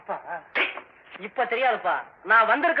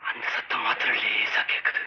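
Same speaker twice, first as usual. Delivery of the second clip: very low, slow, hushed horror narration; spooky storytelling; 11 semitones lower, 8 dB quieter than usual. Acted. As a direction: draw the listener into dread. (திகில் கதை சொல்லும் குரல்)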